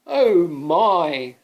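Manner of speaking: loud, high little gasp; onlookers' worry; alarmed gasp. Acted. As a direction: gasp at the danger. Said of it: "thought all the other people".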